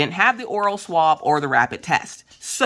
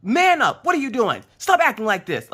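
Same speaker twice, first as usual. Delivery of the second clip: high, loud self-scolding; acted-out frantic pep talk; yelling at oneself; 9 semitones higher, 4 dB louder than usual. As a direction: scold yourself to toughen up. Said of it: "I'm yelling at myself to calm the hell down".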